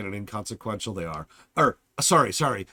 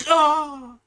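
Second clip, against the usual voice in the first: battle yell; 7 dB louder than usual. Acted. Mock battle cry.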